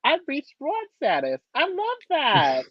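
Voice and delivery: high, drawn-out delight; mock delighted. Reacting to being called a broad, high and delighted. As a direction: savour it, slow and high. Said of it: "I'm like".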